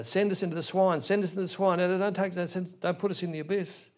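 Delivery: high, chattering mock begging; clamouring demons. Many demons clamour from one man's throat, begging noisily. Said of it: "Imagine the noise and the chatter".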